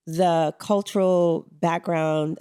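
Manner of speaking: usual speaking voice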